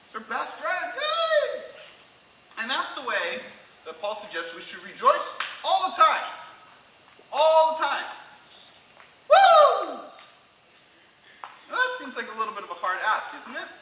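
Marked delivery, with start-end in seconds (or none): 0.9-1.6 high, loud squealed cheer; excited cheer. Retold or imitated. Friend greeting best friend, excited squeal. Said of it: "they're excited to see each other". 9.3-10.0 high, loud party whoop; exuberant whoop. Acted. Preacher showing constant rejoicing, exuberant whoop. Demonstrating rejoicing all the time.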